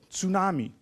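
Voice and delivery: normal talking voice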